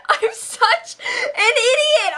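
slow, high crying wail. Pretend. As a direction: drag it out in a mock sob. Repeats it wailing.

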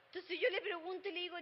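usual speaking voice

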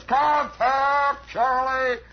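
mock pilot's holler; hollered command. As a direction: holler it up from the cockpit. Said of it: "this guy hollers".

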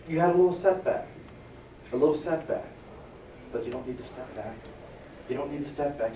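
soft, low inner voice. Retold or imitated. Inner voice telling himself not to step back, soft. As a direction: murmur it like a voice inside. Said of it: "It was like this".

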